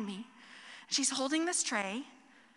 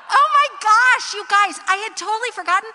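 much higher, much louder, fast exclamation; excited outburst; 8 semitones higher, 17 dB louder than usual. Real, not acted. Pastor telling congregation her mishap, excited outburst. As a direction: burst out laughing at herself. Recalling being caught out.

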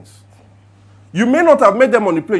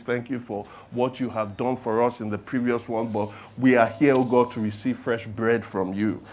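soft, low-pitched prayer voice, unhurried; 6 semitones lower, 4 dB quieter than usual. Acted. Pastor praying over the sermon series, soft and low. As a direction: pray it quietly and reverently.

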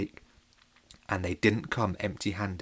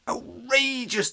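high, stretched-out indignant gasp; outraged cry; 12 semitones higher, much slower than usual. Acted. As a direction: cry out in shock. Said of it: "they cried".

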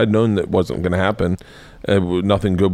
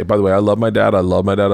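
normal talking voice

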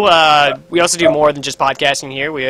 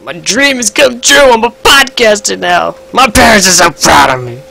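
mock pride; 6 semitones higher, 9 dB louder than usual. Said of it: "I'm kidding".

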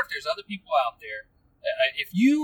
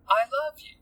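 higher, lilting imitation of a child; sweet bubbly little girl; 7 semitones higher than usual. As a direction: lightly mimic a sweet daughter, pitch climbing. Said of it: "It was her sweet little bubbly voice".

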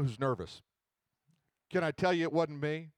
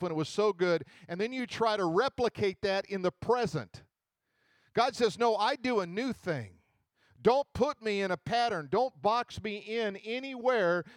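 higher and louder, driving sermon voice; fiery preaching; 5 semitones higher, 4 dB louder than usual. Acted. Urging the congregation to let God work, in fiery preaching. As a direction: preach it to the back row.

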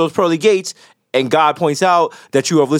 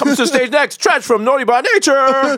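stage announcer; 9 semitones higher than usual. Pretend. Mock announcer introducing act.

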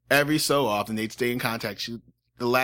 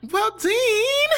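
a mother's voice pitched way up and put on; high, doting Southern mom calling her son; 5 dB louder than usual. Podcaster plays Dean's mother defending her son, high put-on voice. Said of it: "the mom voice".

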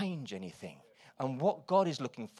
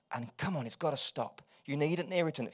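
ordinary delivery

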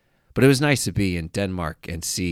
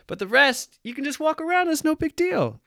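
very high, squeaky comic delivery; high-pitched mock voice. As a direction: pipe it way up high, jokingly. Joking aside to the women.

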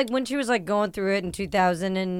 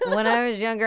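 ordinary delivery

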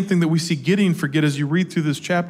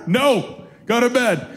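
higher, curt dad command; mock stern refusal; 6 semitones higher, slower than usual. Dad sending his daughter back to bed, mock stern. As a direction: shut the kid's request down firmly.

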